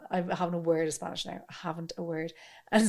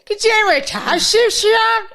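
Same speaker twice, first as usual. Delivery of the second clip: imitating a Donegal Irish tape voice, much higher and louder; hammed-up Donegal twang, pitched up and loud; mock Donegal accent; 12 semitones higher, 12 dB louder than usual. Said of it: "what does he say".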